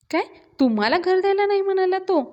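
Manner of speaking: very high, incredulous question; shocked disbelief. A narrator's reading. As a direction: ask it high-pitched, outraged for her. Repeats shocked question to Avni.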